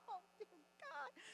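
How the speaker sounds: high, soft tearful cry; overcome, half sobbing. Real, not acted. Cry heard, far timbre.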